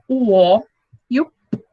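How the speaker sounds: ordinary delivery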